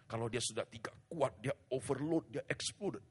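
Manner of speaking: rising preaching. Real, not acted. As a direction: build to the explosion. Pastor building to explosion. (khotbah makin keras)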